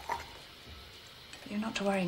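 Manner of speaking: soft, breathy whisper. Real, not acted. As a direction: hush it reassuringly. Mother comforting son before results.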